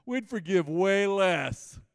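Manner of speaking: laughing, stretched slowly. Real, not acted. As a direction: laugh through the punchline. Laughing punchline.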